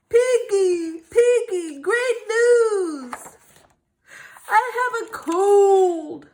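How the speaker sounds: high, loud happy cry; overjoyed. Acted. Gerald happily calling to Piggy. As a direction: shout the good news.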